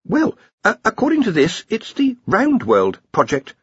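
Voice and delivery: put-on a young researcher stammering out an answer; nervous young wizard. Ponder nervously explaining to the faculty.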